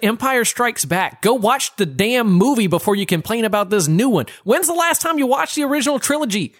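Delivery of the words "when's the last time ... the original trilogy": high-pitched excited rant; worked up and pleading. Real, not acted. Worked-up defence of the movie.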